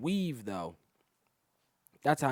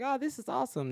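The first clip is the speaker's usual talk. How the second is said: put-on girls' voice; 13 semitones higher than usual. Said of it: "They'd be like".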